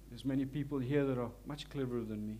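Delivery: ordinary delivery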